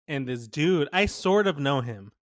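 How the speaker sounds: ordinary delivery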